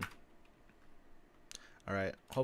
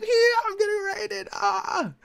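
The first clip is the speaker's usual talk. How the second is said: much higher, cartoonish panicked squeal; mock raided player; 4 dB louder, slower than usual. Mocking the base owner freaking out over the raid, hammed-up squeal. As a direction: ham up the victim freaking out. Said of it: "He's like".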